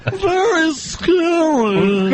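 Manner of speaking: very high, drawn-out mock fright; high-pitched parody of a frightened caller; mocking scared woman. Mimics the clip's frightened caller.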